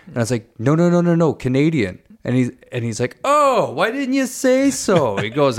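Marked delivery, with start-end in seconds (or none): 0.6-2.0 higher, insistent string of denials; hurried protest. Retold or imitated. Tourist correcting an angry Scottish driver, hurried protest. 3.2-5.2 high, drawn-out relieved exclamation; slow, high-pitched change of heart; relieved Scottish bus driver. Retold or imitated. Bus driver learning the tourist is Canadian, exaggerated sudden friendliness. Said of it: "not gonna try and do his accent".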